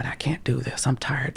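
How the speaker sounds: drained, whispered complaint; weary whisper. Retold or imitated. Retelling her burnout to her boyfriend, whispered weariness. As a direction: whisper it like you're out of energy.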